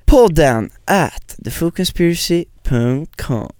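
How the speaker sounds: slow, careful address read; spelled-out address. Acted. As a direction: read it out for listeners. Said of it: "vilken adress har vi fått in dem på". (långsam tydlig uppläsning)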